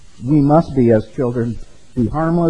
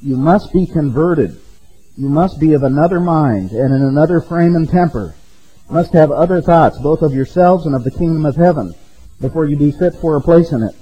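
forceful quoted reading; 2 semitones higher, 5 dB louder than usual. Acted. Preacher reads Matthew Henry forcefully.

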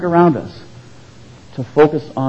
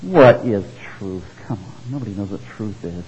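lower, softer scoffing Pilate; mocking; 5 semitones lower, 4 dB quieter than usual. Pilate mocking Jesus before the crucifixion, scoffing low. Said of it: "in his mocking voice".

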